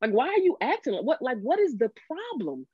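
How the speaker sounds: a hurt husband who has had enough; frustrated outburst. Retold or imitated. Husband retelling how he snapped at his wife, exasperated.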